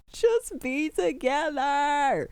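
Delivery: high, drawn-out pleading wail; whiny, stretched-out fangirl plea; exasperated pleading. Real, not acted. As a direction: beg them slowly, pitch way up. Pleads with the show's couple.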